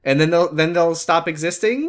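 high, loud sing-out of a silly plan; sarcastically chirpy, rising at the end; mock naive. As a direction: lift it into a hopeful mock question. Mocking officials' naive plan.

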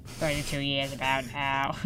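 low, soft, dragged-out smoker voice; chain smoker. Pretend. Teen repeating the chain smoker bit, low and slow. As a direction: drag it out like an old smoker. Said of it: "being, like, chain smokers".

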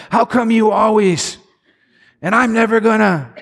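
husband lashing out at his wife, mock accusing; accusing outburst.

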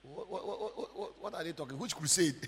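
stammered, bewildered "what" repeated rapidly; acted confusion of a stunned eavesdropper; startled stammer. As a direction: stumble on the first word in acted disbelief.

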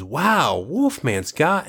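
put-on high, slow awed kid voice; amazed kid. Retelling the trailer, a kid's awed line. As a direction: gasp it out like a wowed kid. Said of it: "one of the kids goes".

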